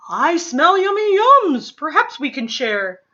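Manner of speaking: loud, high, greedy little badger; eager badger. Badger smelling food at the party, loud and eager. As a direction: sniff out the treat and ask eagerly.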